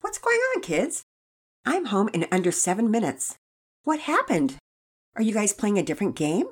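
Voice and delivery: a mother puzzled at what she finds; surprised mother. Acted. Mother arriving home, surprised.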